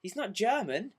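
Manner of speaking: high, loud, slowed mock panic; imitating Julian. Julian falling for the joke, mock panic. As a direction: play the flustered friend, squealing. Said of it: "and he'd be like".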